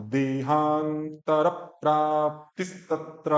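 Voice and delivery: देहांतर प्राप्तिस्तत्र slow and even, each cluster clear; slow model recitation. Shown as a demonstration. Teacher modelling the line देहांतर प्राप्तिस्तत्र slowly.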